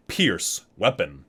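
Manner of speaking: ordinary talk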